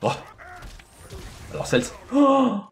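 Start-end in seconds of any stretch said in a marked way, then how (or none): 2.1-2.6 loud, high gasp of surprise; sudden high startled oh. Real, not acted. Startled during game combat.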